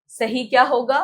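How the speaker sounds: ordinary delivery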